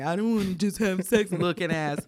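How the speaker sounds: teasing, mocking read-out of a text. Shown as a demonstration. Podcaster showing co-host how her text was meant, mocking. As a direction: mimic it in a mocking way. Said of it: "Was the mocking way".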